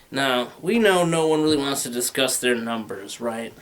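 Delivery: usual speaking voice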